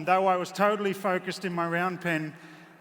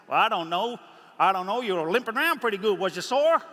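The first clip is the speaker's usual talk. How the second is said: imitated American gentleman; 3 semitones higher, 5 dB louder than usual. The American man asking if he was sore.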